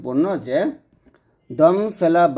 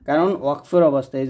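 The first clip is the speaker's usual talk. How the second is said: ordinary talk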